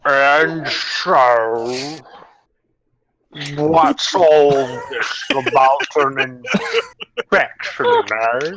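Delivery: put-on slow, drawn-out Vogon accent. Manager questions the surprise inspectors, slow Vogon accent. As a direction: draw the vowels right out in a Vogon accent. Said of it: "Uh, Vogon".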